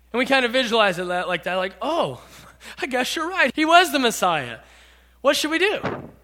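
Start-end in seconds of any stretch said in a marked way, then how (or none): 1.8-6.1 higher, light, mock-mild realization; mild, casual concession. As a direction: play it as an untroubled little "oh well". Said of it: "We kind of look at it like that".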